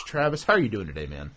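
usual speaking voice

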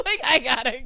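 high burst of laughter; cracking up at her own story; laughing. Real, not acted. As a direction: laugh through it. Laughing at own abrupt exit.

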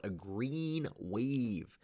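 slow, savoring each word; slow drawn-out slogan. Acted. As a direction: stretch it out like a slogan. Slogan said slowly.

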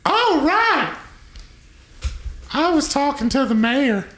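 high squeaky attendant voice; gnome character voice. Acted. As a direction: squeak it out, defensive.

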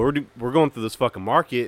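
ordinary talk

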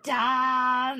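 loud, high call of the name; group's exasperated cry. Retold or imitated. Whole group exclaiming at Tom.

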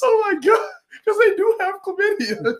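very high-pitched real squeal; scandalized disbelief. Real, not acted. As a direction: squeal as the crude joke sinks in. Shocked, laughing at a crude joke.

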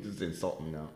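normal talking voice

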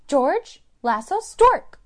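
mock line. Retelling a movie scene, mock line.

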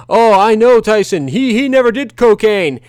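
high, loud mocking voice of smug fans; mocking know-it-all fans. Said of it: "I had all these people saying".

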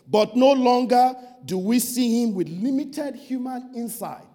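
loud, slow, proclaimed quote; preaching scripture. Acted. Preacher quoting Paul to the congregation, loud proclamation. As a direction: proclaim the verse with force. Reads Passion Translation loudly.

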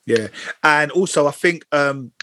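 ordinary talk, nothing marked

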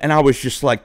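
ordinary talk, nothing marked

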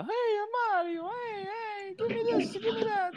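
squeaky, pleading mock voice; Mario. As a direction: whine in a tiny cartoon plumber squeak. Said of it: "this guy with the squeaky voice".

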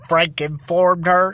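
ordinary delivery

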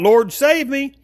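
high-pitched plea, retold; crying out. Peter cries out to the Lord as he sinks, retold high cry. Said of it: "He cried out".

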